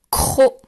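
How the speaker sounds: "croc." hard and loud; dictated syllable. Shown as a demonstration. Teacher dictates the last syllable to pupils, loudly articulated. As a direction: punch out "croc." with a forceful opening sound. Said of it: "Et dernière syllabe".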